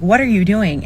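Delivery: ordinary talk, nothing marked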